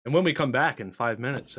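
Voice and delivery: ordinary talk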